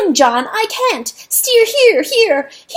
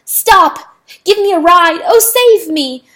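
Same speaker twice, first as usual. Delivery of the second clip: higher loud frantic pleading; pleaded; 9 semitones higher, 9 dB louder than usual. Acted. Rooster pleading with the dog, loud frantic begging. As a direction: beg for your life. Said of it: "Chanticleer pleaded".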